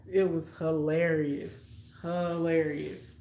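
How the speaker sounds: ordinary talk, nothing marked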